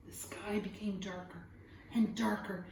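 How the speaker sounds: whispered storytelling suspense. A narrator's reading. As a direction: breathe it, build the storm's dread.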